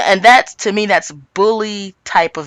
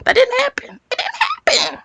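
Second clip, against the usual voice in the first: very high-pitched mock squeal; hammed-up high little whine; squealed disbelief. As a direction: let the voice shoot up into falsetto. Mocking disbelief that it never happened.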